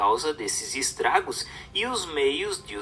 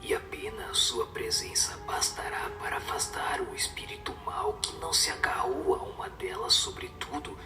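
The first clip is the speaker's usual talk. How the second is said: soft, low, unvoiced whisper throughout; whispered spirit's message; 5 semitones lower, 8 dB quieter than usual. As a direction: breathe the spirit's counsel as a hushed whisper. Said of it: "o conselho que deu um espírito superior".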